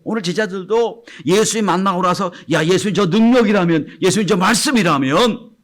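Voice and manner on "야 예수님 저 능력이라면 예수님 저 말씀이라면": eager. Retold or imitated. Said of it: "생각하고".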